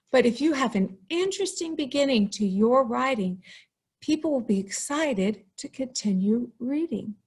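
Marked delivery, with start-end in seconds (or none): none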